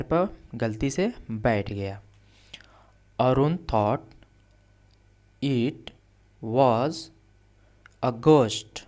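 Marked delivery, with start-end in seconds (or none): 3.2-8.8 word by word dictation. Shown as a demonstration. Teacher dictates English sentence word by word.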